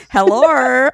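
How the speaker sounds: put-on Australian accent. Host caught copying a guest, mock Australian accent. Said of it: "not to do your Australian accent".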